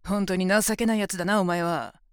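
loud, disgusted scorn; loud sneering put-down; loud contempt. Acted. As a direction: spit out the contempt. Contempt at his begging.

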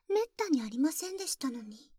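ordinary talk

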